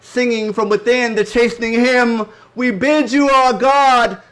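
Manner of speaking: loud, high, slow declamation; impassioned poem recitation. Acted. Poet reciting grandparents' Middle Passage sorrow, loud impassioned declamation. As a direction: ring out each phrase with drawn-out stresses.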